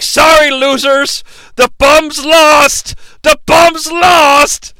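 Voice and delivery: high, slow mock holler; taunting shout. Lebowski turns the hungry down, drawn-out taunting shout. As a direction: bellow it, stretching each last word. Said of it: "And Lebowski's like".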